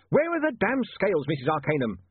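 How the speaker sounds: high-pitched desperate demand. A narrator's reading. Lodger begging his landlady for the scales, desperate. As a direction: plead urgently, pitch leaping up then falling. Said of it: "said William desperately".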